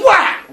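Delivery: high, loud sound effect; loud whack. Acted. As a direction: slam the word like the axe hit. Sound of axe hit.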